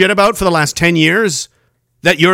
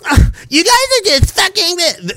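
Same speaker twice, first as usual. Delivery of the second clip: mocking dismissive voice; 9 semitones higher than usual. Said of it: "They were sitting around going".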